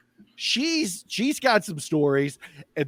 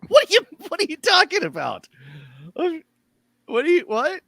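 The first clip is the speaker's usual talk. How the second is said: much higher, louder indignant; indignant Hutch; 4 dB louder than usual. Acted. Hutch denies being fake to Seal, indignant. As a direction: protest in disbelief.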